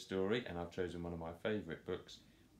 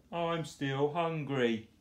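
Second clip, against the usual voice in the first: higher, louder, slow whine; mock crying; 7 semitones higher, 5 dB louder, slower than usual. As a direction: whine for more. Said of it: "cried Boris".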